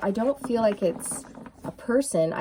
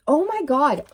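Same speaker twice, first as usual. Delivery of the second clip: excited; 6 semitones higher, 5 dB louder than usual. Real, not acted. Sees heart chakra card.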